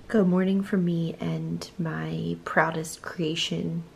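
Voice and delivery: mock solemn whisper; hushed joke whisper. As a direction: breathe it like a secret. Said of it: "Just kidding".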